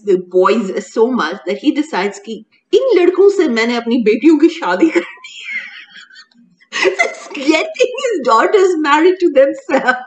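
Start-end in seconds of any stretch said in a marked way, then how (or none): 2.3-10.0 cracking up while telling it, higher pitched; helpless amusement at an absurd plot; laughing. Real, not acted. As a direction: barely get the words out for laughing.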